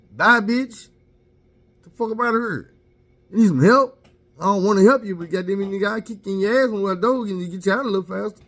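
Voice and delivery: high, loud dismissive yelling; defiant shout. Retold or imitated. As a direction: yell her out of the house. What he should have shouted.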